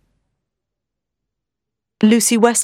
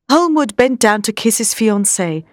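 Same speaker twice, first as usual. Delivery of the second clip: usual speaking voice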